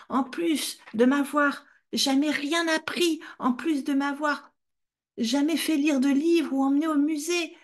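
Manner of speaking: high, tearful rage; child accusing the mother, crying with rage; raging accusation. Retold or imitated. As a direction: spit out the blame in angry tears. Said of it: "Je lui disais / des larmes de rage".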